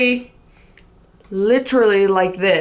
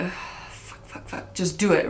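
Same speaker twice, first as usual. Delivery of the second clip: nervous whisper to herself; 8 dB quieter than usual. Retold or imitated. Nervous self-talk walking to him.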